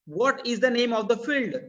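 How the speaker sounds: normal talking voice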